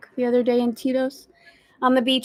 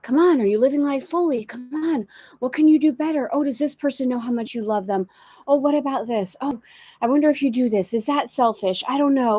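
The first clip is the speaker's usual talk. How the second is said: higher-pitched, pestering self-doubt; nagging inner voice; 4 semitones higher than usual. Acted. Inner voice second-guessing her choices, nagging. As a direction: pile up anxious, prodding questions at yourself. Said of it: "I'm saying it with the energy".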